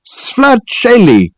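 high, slow, drawn-out cry of recognition; exclaiming. A narrator's reading. Reg recognising his visitor, exclaiming. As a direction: exclaim the name at full stretch. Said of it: "he exclaimed".

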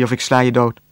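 ordinary delivery

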